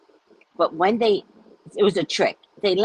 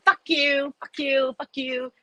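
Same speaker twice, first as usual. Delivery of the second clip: pitched-up taunting fuck you, each one high; high mock cursing; 8 semitones higher than usual. Yelling back at the cursing trucker from her car, high mock taunt. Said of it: "I'm waving my blah".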